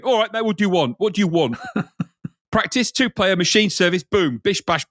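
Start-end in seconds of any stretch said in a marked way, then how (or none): 0.0-1.6 higher, pushy Cockney bloke; a Cockney geezer sizing you up; Cockney guy. Pretend. Menu imagined as a Cockney bloke asking what you want, Cockney. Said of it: "some kind of Cockney guy going".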